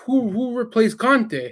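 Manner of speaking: high, drawn-out disbelieving question; imitating incredulous father. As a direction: stretch it out like a baffled dad. Retold father shocked at news.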